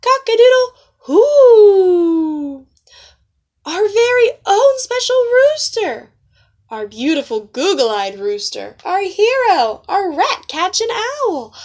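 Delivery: high, loud, slow crowing; shouted. Acted. Proud owl and cheering hens, loud and triumphant. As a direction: crow it out with pride. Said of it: "and shouted".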